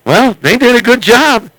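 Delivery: normal talking voice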